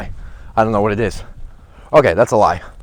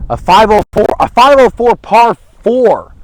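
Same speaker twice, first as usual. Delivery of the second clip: reading the number in shock; surprised; 9 semitones higher, 6 dB louder than usual. Real, not acted. Reading long hole distance.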